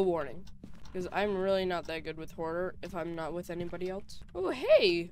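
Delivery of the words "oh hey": sudden high, loud startle; startled. Real, not acted. Playing a ghost game, startled by something: high loud gasp. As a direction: jump at a sudden shock.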